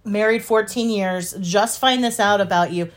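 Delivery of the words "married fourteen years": ordinary delivery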